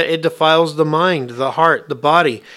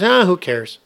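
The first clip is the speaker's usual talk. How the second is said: ordinary talk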